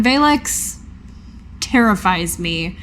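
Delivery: usual speaking voice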